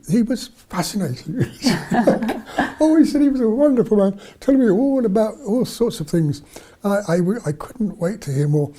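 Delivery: high, gushing imitation of a delighted girl; retold girlish enthusiasm. Rescued girl describing the man who talked to her, retold enthusiasm. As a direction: gush about him, pitched well up.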